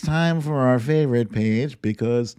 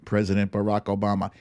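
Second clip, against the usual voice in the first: usual speaking voice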